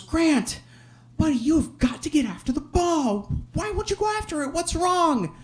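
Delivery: urgent, baffled pleading with his little son; pleading dad. Retold or imitated. Dad pulls son aside.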